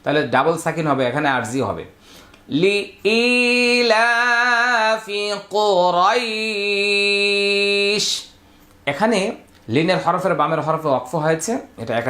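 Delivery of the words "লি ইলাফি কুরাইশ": very slow melodic chant; Quranic recitation. Shown as a demonstration. Teacher showing the stop on Quraish, slow chant. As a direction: stretch every vowel in tajweed style. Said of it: "teacher recites verse".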